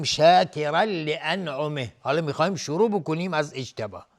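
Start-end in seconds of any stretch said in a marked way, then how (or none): 0.0-1.9 "شاکرا لانعمه" slow and loud, ending on h; stop before restarting. Shown as a demonstration. Teacher pauses before restarting, "شاکرا لانعمه" slow and loud. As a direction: recite "شاکرا لانعمه" firmly and stop on -mih.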